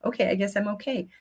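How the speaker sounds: soft, quick, lightly voiced toddler; a hint of a kid talking himself out of crying; shrugging child. Toddler deciding he is fine, soft and quick. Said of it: "And the child goes".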